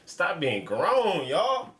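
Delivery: slow, loud and high, stretching every word; emphatic plea. Real, not acted. Reactor lecturing young viewers.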